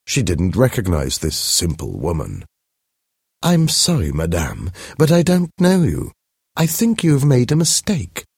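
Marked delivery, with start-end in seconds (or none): 3.4-8.3 high, polite society lady voice, politely puzzled; a fine Parisian lady who doesn't know her; elegant lady, puzzled. Acted. To a shabby stranger on the Champs-Élysées, high polite puzzlement. Madame Forester meets stranger.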